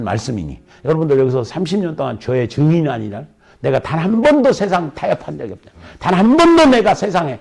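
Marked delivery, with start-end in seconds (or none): 3.6-7.4 from firm to a high, loud cry; preacher testifying his poverty, building to a cry; building preaching. Acted. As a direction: rise to a fervent peak. Preaching, rising to a shout.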